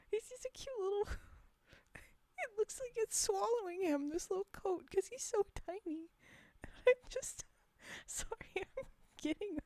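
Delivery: high, tearful cooing; almost emotional. Real, not acted. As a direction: choke up a little over something adorable. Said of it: "almost emotional because he's too adorable".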